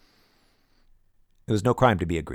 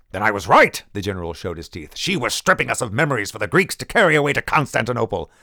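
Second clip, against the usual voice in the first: vindicated anger; 3 semitones higher, 6 dB louder than usual. Acted. General bares teeth, triumphant anger.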